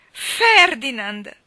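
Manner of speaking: loud, high, stretched-out cry; anguished cry. A narrator's reading. Mally crying his name as if to herself, loudly. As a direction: draw the name out in a shout. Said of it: "gridò Mally per la terza volta".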